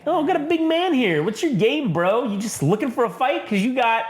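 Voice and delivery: high, mocking punk voice; taunting thug. Punk taunting Cole North for a fight, high and mocking. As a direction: taunt him like a cocky punk.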